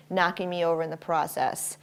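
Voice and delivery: normal talking voice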